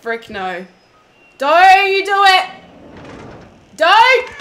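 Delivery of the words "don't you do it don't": loud, high, slow warning yell. Real, not acted. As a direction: yell it out at the monster. Pleading with monster, yelling.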